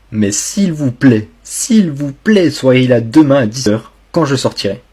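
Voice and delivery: pleading. Real, not acted. Begging followers to show up.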